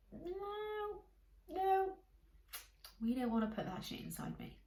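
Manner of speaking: a small body voice pleading no; little voice of the body. Acted. Her body quietly refusing junk food, high little voice. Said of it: "that little subtle voice".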